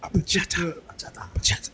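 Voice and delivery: whispered percussive burst; hushed, explosive "ba-CHA-ta"; bachata as gunfire. Pretend. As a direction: spit the word out like a gun going off. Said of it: "That sounds like a type of gun".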